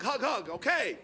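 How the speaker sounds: low, grudging, half-hearted patter. Retold or imitated. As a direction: get it over with reluctantly. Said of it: "frustratingly, and I gave her a little half-hearted hug".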